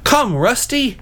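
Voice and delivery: high, theatrical knightly dismay; hammed-up cowardly knight crying out; horrified cry. A narrator's reading. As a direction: declaim in high-pitched alarm. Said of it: "cried the Yellow Knight".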